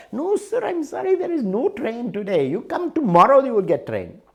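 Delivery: polite clerk. Retold or imitated. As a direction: sweetly insist there is no train. Clerk politely refusing angry traveller.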